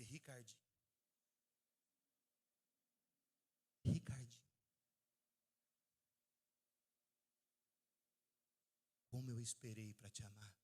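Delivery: Jesus falando com ternura, mais grave e lento; voz de Jesus. Retold or imitated. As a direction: um leve toque de voz de Jesus, amorosa. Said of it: "e disse". (Jesus chamando o filho com carinho, levemente encenado)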